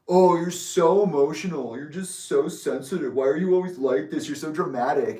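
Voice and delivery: sing-song taunting impression of siblings brushing off her feelings; mocking siblings. Siblings mocking her as dramatic, sneering mimicry. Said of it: "in true Isaiah and Alicia fashion".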